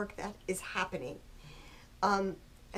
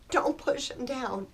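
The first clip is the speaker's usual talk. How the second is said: ordinary talk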